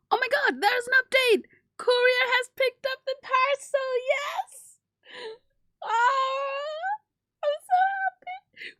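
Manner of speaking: high, loud near-crying joy; excited squeal. Real, not acted. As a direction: squeal with happy disbelief. Package shipped, ecstatic.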